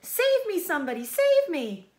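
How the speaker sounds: very high, loud panicked cry; terrified pleading. Acted. Wilbur begging to be saved, high and loud. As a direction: beg for your life.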